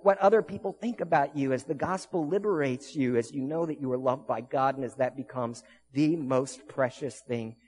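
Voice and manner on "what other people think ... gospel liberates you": high, loud, driving sermon climax; fired-up pulpit preaching; preaching at full force. Acted. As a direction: preach it out to the whole room.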